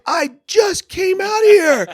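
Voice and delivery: yelling back at the guard in confusion; confused yell. Retold or imitated. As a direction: shout it, baffled.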